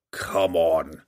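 low, scornful dwarf chide; dwarf chiding the drow to fight; derisive chiding. Acted. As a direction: coax him with contempt. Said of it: "he chided derisively".

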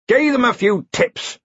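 put-on high, slow, jovial old wizard. A narrator's reading. Ridcully jovially explaining to the queen, high and slow. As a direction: toss it off with hearty cheer. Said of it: "said Ridcully jovially".

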